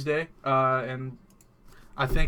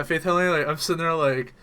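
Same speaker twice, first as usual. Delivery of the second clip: ordinary talk, nothing marked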